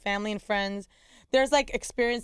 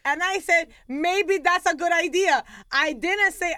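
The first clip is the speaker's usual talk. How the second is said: 1 son correcting mom; 8 semitones higher, 5 dB louder, slower than usual. Retold or imitated. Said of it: "He goes".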